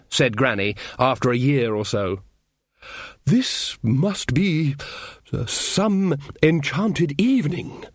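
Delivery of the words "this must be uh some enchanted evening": put-on very slow, dreamy old wizard; slow romantic. Ridcully wooing Granny, slow and dreamy. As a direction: draw it out like a love song. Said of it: "said Ridcully".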